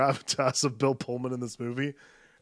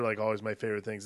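ordinary talk

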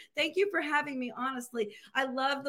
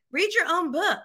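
louder, higher friend's call-out; mock scolding; 4 semitones higher, 5 dB louder than usual. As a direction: call a friend out, playfully loud. Imitates friends calling her out.

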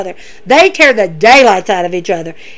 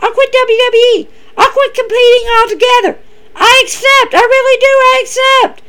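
much higher, hammed-up voice of the challenger accepting; confident challenger; 12 semitones higher than usual. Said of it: "She goes".

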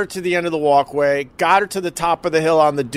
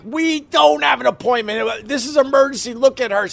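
retold desperate plea; 8 semitones higher than usual. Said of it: "I'm like".

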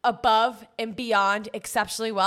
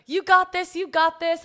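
high, urgent pep talk; mock coaching encouragement; retold cheerleading; 7 semitones higher, 5 dB louder than usual. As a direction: cheer her on, higher and a bit louder. Cheering on the gagging customer.

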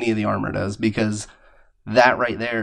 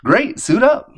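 mock cheer; 12 semitones higher, 6 dB louder, slower than usual. Mock cheer after Baptist aside.

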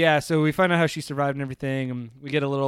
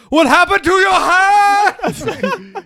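much higher, louder, slow mock wail; hammed-up shriek; 11 dB louder, much slower than usual. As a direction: overplay the concern. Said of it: "It's like".

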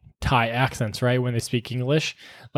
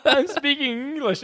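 high-pitched imitation of an affronted customer; mock indignation; 10 semitones higher, 3 dB quieter than usual. Customer tells the store worker it is English, indignant.

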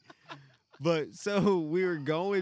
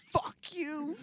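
mom cursing; 11 semitones higher than usual. Retold or imitated.

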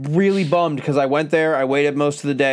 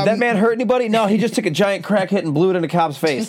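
mock defense plea; 7 semitones higher than usual. Mock juror defending crackhead.

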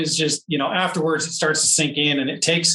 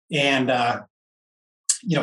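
normal talking voice

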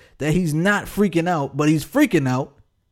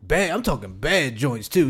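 usual speaking voice

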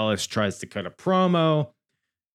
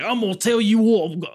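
high, loud, quick attempt at Scouse; badly faked Scouse shout, breaking off; botched Liverpool accent; 11 semitones higher, 7 dB louder, faster than usual. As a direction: attempt a Scouse heel's threat and lose the accent. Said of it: "what the fuck was that accent?".

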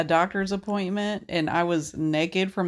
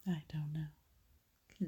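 slow, breathy whisper, much softer; 6 semitones lower, 17 dB quieter, slower than usual. Real, not acted. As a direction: hush it out like a secret. Deadpan whispered answer to joke.

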